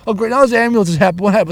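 normal talking voice

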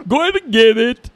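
high, loud Eddie Murphy style voice; loud hammed-up Eddie Murphy take on the line; Eddie Murphy impression. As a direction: ham it up like Eddie Murphy doing an African accent. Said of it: "Like Eddie Murphy".

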